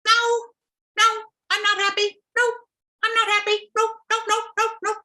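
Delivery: high, loud whining little fish; whiny little fish. Acted. Little fish whining to Mother Nature, high and sulky. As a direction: protest like a sulky child.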